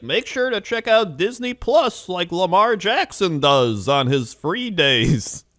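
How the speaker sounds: high, slow cheesy promo voice; ad read. Retold or imitated. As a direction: plug it like a TV ad read. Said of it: "They're like".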